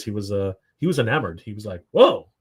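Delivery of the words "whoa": higher, louder impressed whoa; mimicked burst of surprise; taken aback. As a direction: imitate someone pleasantly stunned. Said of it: "Danny was very taken aback by this".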